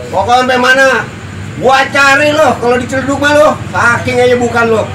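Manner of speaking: angry, fast threatening boast; angry threat. Real, not acted. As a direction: bark the threats as a tough guy. Threatening video, boasting menace.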